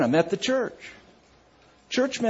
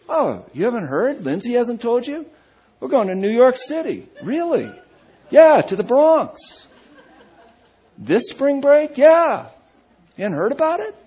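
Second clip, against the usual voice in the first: higher, louder eager gossip; excited church member; 6 semitones higher, 6 dB louder than usual. Retold or imitated. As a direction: gush the news to the pastor.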